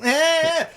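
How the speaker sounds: high sharp yelp; shrill startle noise; mock sudden yelp. Imitating parent shouting at crying baby.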